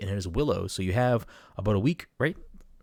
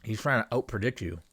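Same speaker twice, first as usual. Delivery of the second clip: talking around ice cube; ice in mouth. Real, not acted. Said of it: "I don't know why I put ice in my mouth while I'm talking".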